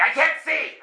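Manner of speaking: high-pitched exasperated yell. Retold or imitated. Driver arguing with the voice in his head, yelling. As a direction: yell back in frustration, half laughing. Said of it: "I'm yelling in my car".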